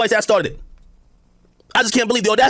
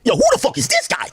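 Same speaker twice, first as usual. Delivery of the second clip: a hint of a cocky fighter, pitched up; higher-pitched, incredulous mock callout; Conor McGregor; 5 semitones higher than usual. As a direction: lightly mimic a fighter's baffled callout. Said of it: "I'm on some Conor McGregor shit".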